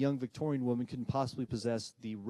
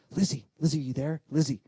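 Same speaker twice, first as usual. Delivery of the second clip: raised, searching call to a spirit; 4 semitones higher, 5 dB louder than usual. Retold or imitated. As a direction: call out for someone unseen. Calling out to a spirit.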